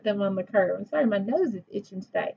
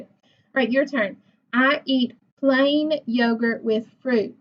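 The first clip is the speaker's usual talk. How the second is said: ordinary talk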